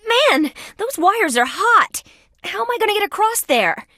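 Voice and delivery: high-pitched worried exclamation; agitated, pitch way up; alarmed. Acted. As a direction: fret about a risky crossing. Stuck up on hot wires.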